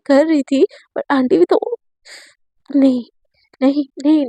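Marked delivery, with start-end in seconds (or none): none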